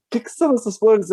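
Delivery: high-pitched imitation of a flustered mother; mãe espantada. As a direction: put on the mother's high questioning voice. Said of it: "minha mãe assim". (imitando a mãe espantada, em voz aguda)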